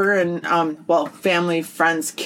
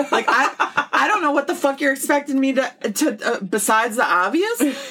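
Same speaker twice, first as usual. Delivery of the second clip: exasperated retort; 6 semitones higher than usual. Retold or imitated. Mock retort to the parent's tactless question.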